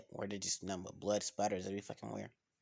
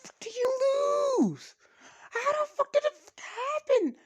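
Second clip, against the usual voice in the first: mock screeching trainer demanding how he lost; high furious coach voice; 7 dB louder than usual. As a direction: rant at the loser in a squealing high voice. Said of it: "Ryan remembers his voice that he heard".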